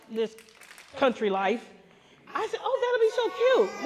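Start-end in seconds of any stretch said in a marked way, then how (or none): 2.6-3.8 squealing cute voice; high, delighted squeal; high cutesy gush. Retold or imitated. Naive city girl quote.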